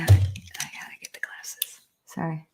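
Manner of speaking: soft whisper, off to the side; whispered aside. Real, not acted. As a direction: whisper it so the stream barely hears. Aside while fetching glasses.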